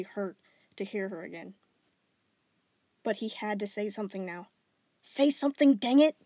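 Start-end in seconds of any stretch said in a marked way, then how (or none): 5.0-6.2 louder, high snap at himself; frantic self-urging. Acted. Boy yells at himself to speak, loud. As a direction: urge yourself on in panic.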